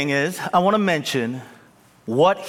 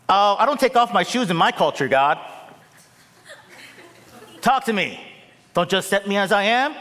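high, fast protesting complaint; hammed-up petulant demand; mock entitled whining; 5 semitones higher, 3 dB louder, faster than usual. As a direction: sass God like a spoiled believer. Mock whiny entitled worshipper.

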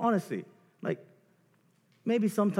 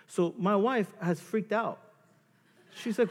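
usual speaking voice

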